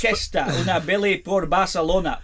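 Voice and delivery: put-on Spanish said lightly in a Yorkshire accent; a Brit ordering in Spain, in a mild Yorkshire accent; mock Yorkshire tourist speaking Spanish. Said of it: "a Yorkshire accent, I think, improves most languages".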